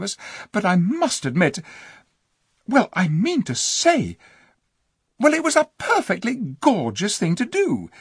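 put-on amazed boy. Eustace to Jill after her daring deed, loud amazed excitement. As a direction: burst out in astonished praise.

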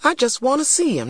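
put-on high, quick little girl's voice; defensive child. A narrator's reading. Girl answers friend's question about lawyer, defensive.

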